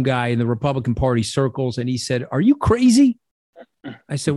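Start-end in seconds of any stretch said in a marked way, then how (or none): 2.3-3.2 high, loud incredulous yell; mock shout. Republican insider yelling at him on the phone, loud mock disbelief. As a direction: throw it out like a stunned phone caller.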